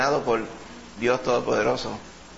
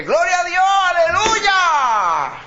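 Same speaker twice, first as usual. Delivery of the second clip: much higher and louder, stretching aleluya; joyful shout; 7 dB louder, much slower than usual. Retold or imitated. Preacher showing the congregation how to praise, ecstatic shout. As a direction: belt it out like a worshipper overflowing. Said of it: "Usted dice".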